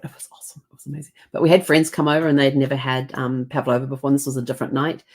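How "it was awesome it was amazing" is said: breathy whisper to herself; whispered aside. Real, not acted. Recalling the pavlova dinner, hushed whisper. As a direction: whisper it like a secret. Fond aside about the dessert.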